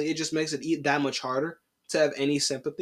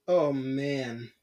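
ordinary delivery

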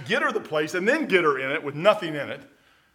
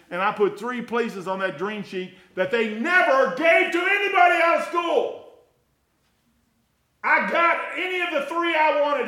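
rising from strong to a high shout; a sermon climbing to a shout; building shout; 9 semitones higher, 4 dB louder than usual. Real, not acted. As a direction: build it up into a full preacher's shout.

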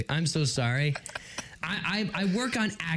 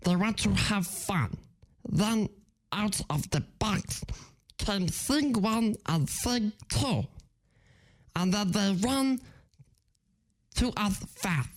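halting alien creature voice; cartoon alien impression; Stitch. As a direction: break it into little bursts, rising at the end. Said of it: "I'm gonna do Stitch".

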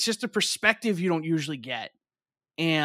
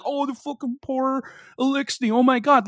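mock melodrama; 7 semitones higher, 3 dB louder than usual. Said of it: "you say shit like this".

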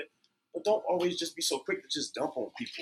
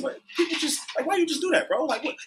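much higher, incredulous exclaim; amazed; 5 dB louder than usual. Retold or imitated. Retelling the cashier paying, exclaiming in high disbelief. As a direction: disbelief at a stranger's kindness. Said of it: "I said".

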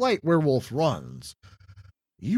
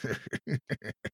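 beastly laugh; 8 dB quieter than usual. Acted. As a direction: chuckle like a hungry beast. Werewolf laughs at Murphys.

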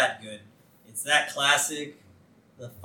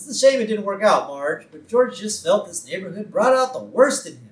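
higher-pitched, polite lady impression; Barbara; 5 semitones higher, 6 dB louder than usual. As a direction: imitate a wife excusing her husband, pitched up. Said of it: "And so Barbara says".

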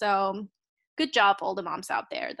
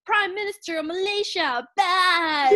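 high-pitched hypnotist chant, louder on the last word; hammed-up high brainwashing voice; 7 semitones higher, 4 dB louder than usual. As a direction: imitate a silly movie villain brainwashing someone. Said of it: "singing Relax, and then going, like".

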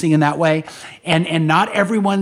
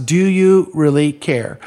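slow and louder, each word weighed; "do you really care?" drawn out and loud; slow pointed question; 4 dB louder, slower than usual. Shown as a demonstration. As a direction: press the question home slowly. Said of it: "but I'm gonna ask".

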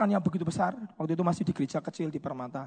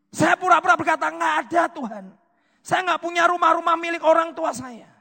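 raised, loud preacher retelling a protest; impassioned preaching; 11 semitones higher, 9 dB louder than usual. Real, not acted. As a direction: plead it loudly from the pulpit. (khotbah bernada tinggi dan lantang)

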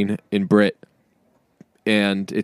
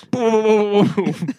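high repeated boom noises; playful rapid-fire boom sound effect; imitated booming; 9 semitones higher than usual. Imitating them running by the embassy, playful booming.

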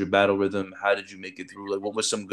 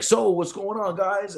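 high, bright mock class greeting; upbeat greeting; 9 semitones higher, slower than usual. Ray greets the class after his backflip, high and upbeat. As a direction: toss it out high and breezy.

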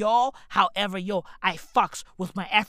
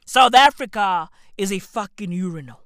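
ordinary talk, nothing marked

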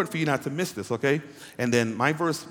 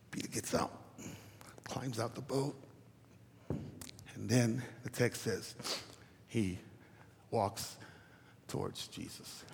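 hushed telling; 3 semitones lower, 14 dB quieter than usual. Real, not acted. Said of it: "I'm saying this for a reason".

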